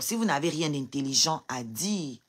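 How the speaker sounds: ordinary talk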